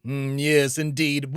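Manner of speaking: ordinary talk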